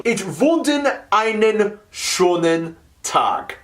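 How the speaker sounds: broken German with an American accent; halting phrasebook tourist. Real, not acted. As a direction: read stiffly off the page. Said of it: "horribly with my broken German".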